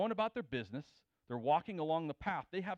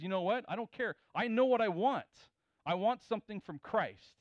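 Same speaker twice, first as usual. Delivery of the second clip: raised, louder, insistent pleading; bold demand; 5 semitones higher, 6 dB louder than usual. Retold or imitated. Gentile woman boldly demanding help from Jesus. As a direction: she pushes past every rule, voice lifted.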